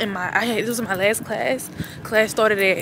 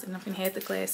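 much softer, half to herself; quiet recap; 12 dB quieter, faster than usual. Shown as a demonstration. Vlogger repeating her plan to herself, softly. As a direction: drop the voice, murmur the plan.

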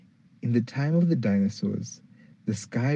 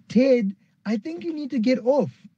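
much higher put-on voice, alarmed; worried Professor Pablo; 14 semitones higher than usual. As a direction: call up to Ted in a fluster. Professor Pablo warns Ted.

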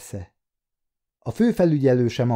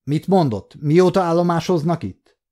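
sudden sharp demand, pushed up and louder; aggressive question; 6 semitones higher, 4 dB louder than usual. A narrator's reading. Savage suddenly demanding of Davien, aggressive. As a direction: fire the question at him like an accusation. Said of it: "hirtelen agresszívan azt kérdezte".

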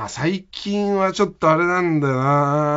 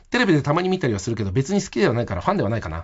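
fast, unmodulated imitation of a boy; monotone child, imitated; 4 semitones lower, faster than usual. Schoolboy answering into the offered mic, imitated quick monotone. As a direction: rattle it off without intonation. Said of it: "抑揚つけて言え、せめて".

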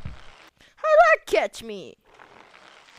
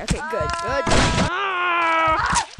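usual speaking voice

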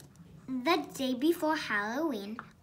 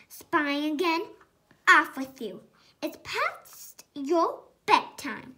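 louder, scolding big sister; shouted scolding; 3 semitones higher, 6 dB louder than usual. A narrator's reading. Big sister shouting at spying Wendy, scolding. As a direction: shoo her off to bed. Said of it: "Polly saw her and shouted".

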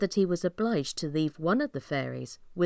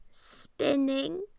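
put-on old lady voice; 8 semitones higher than usual. Old lady answers princess.

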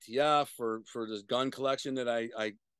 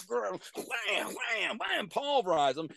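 punch sounds; 8 semitones higher, much slower than usual. Retold or imitated.

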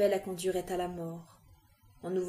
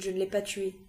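ordinary delivery, nothing marked

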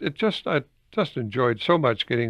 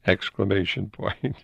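usual speaking voice

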